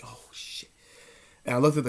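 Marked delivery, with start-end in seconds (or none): 0.0-0.7 frightened whisper. Retold or imitated. Spooked by a shadow in the covers, whispered. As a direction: breathe it out scared.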